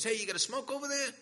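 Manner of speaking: high, loud, fast imitated holler; acted neighbour's yell; calling over. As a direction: shout quickly to the next cell. Neighbour calling from next cell.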